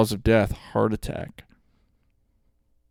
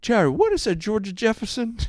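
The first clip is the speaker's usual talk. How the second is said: put-on an old Italian grandpa asking Jerry for a movie; old Italian grandfather; 11 semitones higher than usual.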